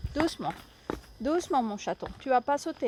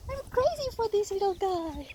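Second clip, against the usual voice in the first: gushing pet talk; 7 semitones higher than usual. Real, not acted. Gushing over her horse.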